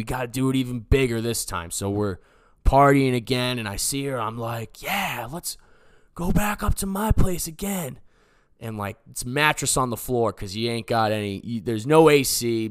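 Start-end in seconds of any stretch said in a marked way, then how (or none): 4.8-8.0 eager invitation. Retold or imitated. Acting out eager invitation to her.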